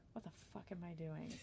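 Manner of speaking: very soft, under the breath; muttered despair. Retold or imitated. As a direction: mutter it quietly to yourself. Repeats the despairing inner question.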